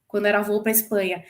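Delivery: ordinary delivery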